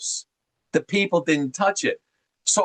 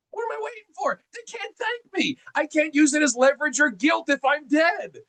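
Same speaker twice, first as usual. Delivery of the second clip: exaggerated grandparent; 10 semitones higher than usual. Grandparent jokingly justifying early gifts, high and mocking. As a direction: ham up the grandparent's reasoning in a high voice. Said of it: "I'm exaggerating".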